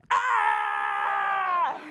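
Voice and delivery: long sustained scream; mock scream. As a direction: let out a drawn-out mock scream. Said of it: "we're just screaming".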